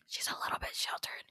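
a hushed aside meant to go unheard. Retold or imitated. Friend whispers about her to the newcomer, a stage whisper. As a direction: whisper it behind her back, badly hidden.

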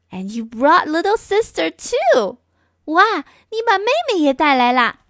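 a hint of a thrilled girl; cheerful little girl. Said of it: "Ganin looked at him and said".

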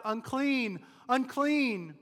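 loud, high call of a leper; leper's cry. Acted. The leper warns people away, loud high cry. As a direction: shout the warning like an outcast leper.

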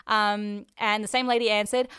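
ordinary delivery

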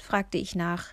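ordinary delivery, nothing marked

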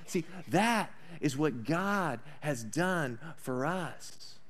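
ordinary delivery

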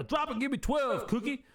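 high barked order; drill sergeant. Retold or imitated. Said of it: "He calls him Cookie".

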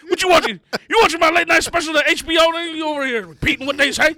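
hammed-up scold of a kid copying TV; pretending to scold a toddler for repeating a comedy special; mock scolding.

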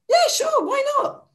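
high, eager squeaky yes; tossing off an easy yes; chirpy yes. Retold or imitated. To the party host, a high eager yes. Breezy yes to dancing gig.

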